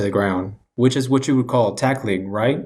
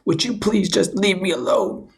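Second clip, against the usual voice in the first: whiny Brady; 9 semitones higher than usual. Retold or imitated. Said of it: "He's just like".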